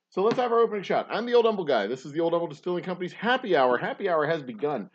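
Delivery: high, bright host opener; showman opening. Acted. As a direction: kick off the show with gusto. Restarts show opening.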